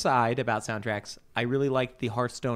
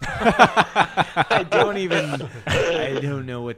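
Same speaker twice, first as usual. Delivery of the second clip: slow, laughing, pitched up in disbelief; 3 semitones higher, 6 dB louder, much slower than usual. Real, not acted. Friend reacting to the barbecue sauce rage quit, laughing. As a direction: laugh through the words at the absurd image.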